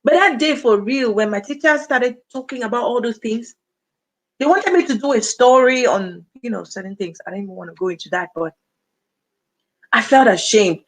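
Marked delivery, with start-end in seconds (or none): none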